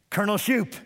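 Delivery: barked military officer greeting; gruff military voice. Retold or imitated. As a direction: answer like a stern army colonel. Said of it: "in his most gruff military voice".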